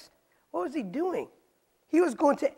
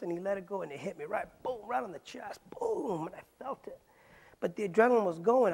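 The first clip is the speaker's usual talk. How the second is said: ordinary talk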